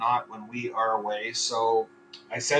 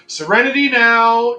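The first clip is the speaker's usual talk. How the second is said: mock shout; 8 semitones higher, 11 dB louder than usual. Venting frustration with a catchphrase.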